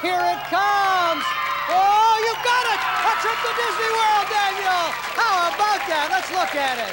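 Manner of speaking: excited shout. Real, not acted. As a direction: build up, then burst into a cheer. Host reveals the Disney World prize.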